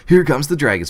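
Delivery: high, loud burst of excitement; excited acted cry. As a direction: call it out, reliving the thrill. Reliving the dragons' arrival.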